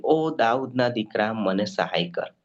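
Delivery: ordinary talk, nothing marked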